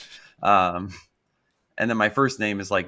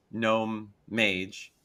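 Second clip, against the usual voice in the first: ordinary talk, nothing marked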